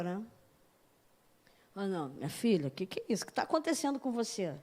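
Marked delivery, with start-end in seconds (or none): 1.7-4.5 lower, softer, tender rebuke; gentle rebuke. Retold or imitated. God softly scolding the preacher like a daughter. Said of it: "Ele me dá logo uma, uma bronca".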